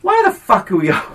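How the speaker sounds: high-pitched imitation of an exasperated fiancé; mock angry retort.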